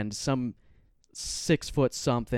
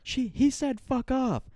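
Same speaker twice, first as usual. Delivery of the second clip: high-pitched mock scream, drawn out; slow, much higher mock shriek; imitated screaming; slower than usual. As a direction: mimic her screech without really yelling. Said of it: "flipping me off and screaming".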